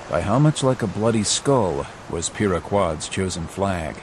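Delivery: ordinary delivery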